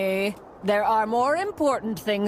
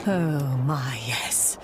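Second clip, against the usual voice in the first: breathless low whisper; awed whisper; 8 semitones lower, slower than usual. Acted. Villain marvelling at the jelly power, awed whisper. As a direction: whisper it in greedy awe.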